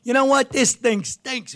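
high indignant rant slowing to a slow jeer; an old comic who won't redo his bit; imitating Pat Cooper fuming. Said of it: "He starts screaming".

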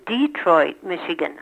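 "Detroit" as "DEE-troit"; stressed DEE. Shown as a demonstration. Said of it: "now I hear people saying".

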